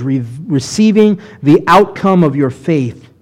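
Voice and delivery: ordinary talk, nothing marked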